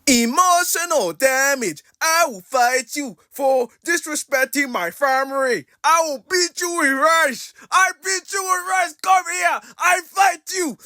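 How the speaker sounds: put-on high, slow, shouted Vietnamese accent. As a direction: yell it in a hammy Vietnamese accent. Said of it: "Let me do my best Vietnamese accent portrayal".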